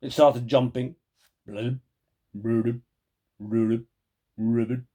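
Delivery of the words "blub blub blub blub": frog croaks. Acted. Frog noises in story.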